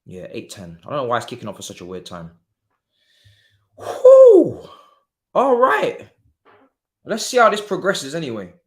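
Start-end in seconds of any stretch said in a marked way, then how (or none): 3.8-4.7 loud whew. Real, not acted. Reacting to the match, big exhale.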